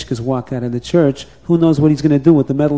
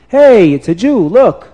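louder, higher startled call; surprised cry; 6 semitones higher, 5 dB louder than usual. Acted. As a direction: point him out in surprise.

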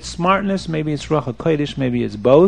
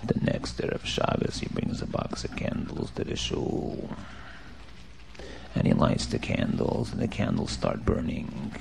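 near-whispered, very soft narration; hushed storytelling; 12 semitones lower, 15 dB quieter than usual. A narrator's reading. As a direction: tell it in a suspenseful whisper.

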